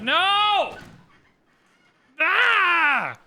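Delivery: high, loud wail of dismay; anguished cry. Real, not acted. Streamer watching the Moksha plan fail, loud anguished wail.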